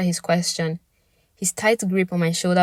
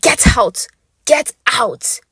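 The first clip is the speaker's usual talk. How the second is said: higher, louder angry yell; loud, higher repeated yell; furious shout; 7 semitones higher, 7 dB louder, much slower than usual. Acted. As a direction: drive him out. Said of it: "pour my frustration on him".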